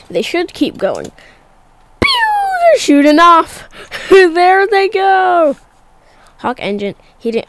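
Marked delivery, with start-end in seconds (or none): 2.0-5.6 excited. Real, not acted. Boosters separating.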